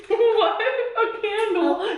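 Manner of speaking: surprised laugh, drawn out; laughing disbelief. Real, not acted. Baffled at candle flavor.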